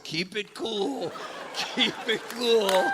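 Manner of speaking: put-on high, soft, slow little-old-lady voice; slow high croon, like a conspirator at the door; tiny frail mom. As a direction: imitate a tiny mom, drawing out each word. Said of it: "it's just my mom, and it just says".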